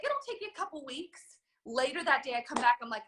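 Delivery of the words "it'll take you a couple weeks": high, light, voiced-over upline; upline's advice. Upline telling the newcomer how long the modules take, high and light. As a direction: breezily reassure a newcomer.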